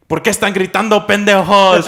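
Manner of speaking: high, loud scolding grandma; angry grandma. Retold or imitated. Grandma catching drunk grandsons at night, high loud scolding. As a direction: scold the boys like an angry abuela. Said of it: "She started getting fucking pissed".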